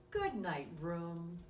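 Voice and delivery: put-on lively bedtime story read to a small child; reading to children. Reading a bedtime story to young listeners, lively and gentle. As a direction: read it like a bedtime picture book. Reads picture book aloud.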